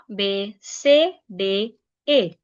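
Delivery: normal talking voice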